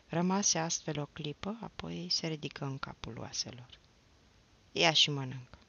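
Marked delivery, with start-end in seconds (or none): 4.7-5.6 firm, slightly louder fatherly order; understated stern command; same forceful voice. A narrator's reading. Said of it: "rosti cu același glas omul".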